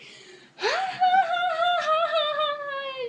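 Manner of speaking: loud, high hysterical cackle; long loud giggling fit; mock laughing fit. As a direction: burst into uncontrollable laughter. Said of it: "I'm just gonna be like".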